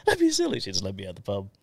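ordinary talk, nothing marked

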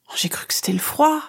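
high breathy whisper, still shaken; a weak half-whispered excuse; dazed whisper. Acted. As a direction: whisper it, barely recovered. Still dazed after the fall.

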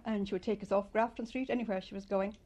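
ordinary delivery

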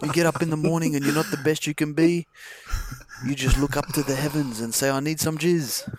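put-on hammy New Zealand accent through laughter. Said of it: "that's vaguely New Zealand accent".

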